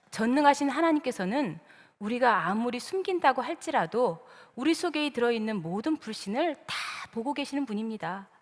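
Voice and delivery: usual speaking voice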